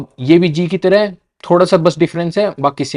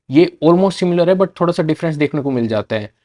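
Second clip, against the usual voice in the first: ordinary talk, nothing marked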